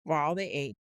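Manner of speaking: usual speaking voice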